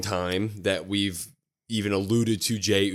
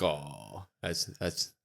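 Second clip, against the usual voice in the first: much softer, wounded mutter; pained aside; 3 semitones lower, 8 dB quieter, slower than usual. Pretend. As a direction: quietly feel the sting. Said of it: "I'm like".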